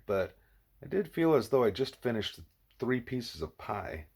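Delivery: ordinary delivery, nothing marked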